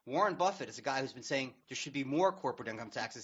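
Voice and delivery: ordinary talk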